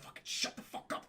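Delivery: unvoiced urgent whisper; urgent hiss. Hero hushing a bungler during the scan, urgent whisper. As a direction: whisper it harshly to silence someone.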